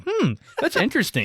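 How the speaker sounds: high, mock-pleasant musing; smiling interest. Imitating a woman smiling at her phone, mildly intrigued. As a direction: put on a mildly intrigued smile, pitched high. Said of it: "She was just smiling at her phone".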